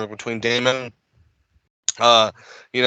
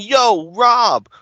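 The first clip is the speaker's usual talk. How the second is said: put-on Brooklyn accent; 9 semitones higher, 4 dB louder, much slower than usual. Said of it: "do they do Brooklyn accents at me".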